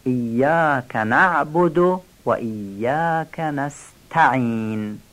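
نعبد with a full ayn, نستعین with a crisp t; slow careful verse, sounding the t of نستعین; clear ayn and t. Shown as a demonstration. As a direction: recite ایاک نعبد و ایاک نستعین slowly, marking each ayn. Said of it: "عین نعبد و ته نستعین خوب بیان بشه".

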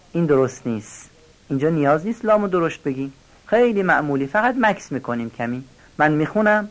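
ordinary delivery

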